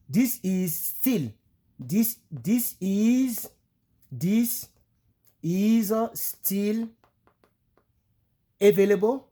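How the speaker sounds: "This is still available?" slow and high, word by word; francophone phrasing, slow. Shown as a demonstration. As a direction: sound it out slowly like a French speaker would ask. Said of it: "C'est comme ça, nous, on allait demander".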